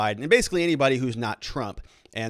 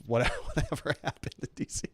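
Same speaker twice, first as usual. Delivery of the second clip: laughing; 5 dB quieter, faster than usual. Real, not acted. Mocking remark about DC.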